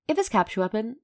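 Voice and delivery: plain reading voice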